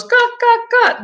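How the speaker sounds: high squawking gawk, gawk; alligator noise. Retold or imitated. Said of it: "the alligator goes".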